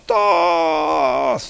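high, drawn-out mock scream; hammed-up dying cry; imitated scream. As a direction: scream the name out long, playfully. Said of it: "and he screams out".

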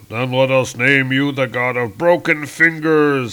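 loud, grand villain's retort; menacing Galactus. Acted. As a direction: proclaim it like an offended giant. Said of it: "says Galactus".

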